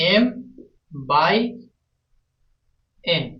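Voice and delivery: "एम", "by", "एन" spoken separately with long pauses; word-by-word reading. Shown as a demonstration. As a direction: read out "एम by एन" slowly, one word at a time. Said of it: "इसको ऐसे पढ़ेंगे".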